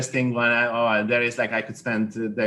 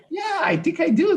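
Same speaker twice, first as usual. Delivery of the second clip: much higher, lightly put-on voice; retold high reply. Masseur to his client, pretending not to worry, in a high put-on voice. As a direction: toss it off in a high, mock-casual voice. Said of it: "And I'm like".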